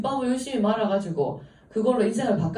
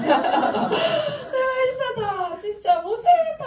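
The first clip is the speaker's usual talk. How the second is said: very high hammed-up wail; exaggerated sobbing complaint; mock crying; 4 dB louder, slower than usual. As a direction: imitate a caller bawling in despair. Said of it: "하면서 막 우는 거예요".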